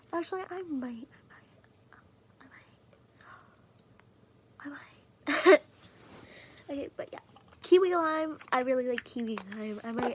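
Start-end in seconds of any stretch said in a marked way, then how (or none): 0.1-4.8 a sly little whisper. Real, not acted. Teasing about writing on screen. 5.3-5.6 a sudden loud, playful blurt. Real, not acted. Teasing about writing on screen.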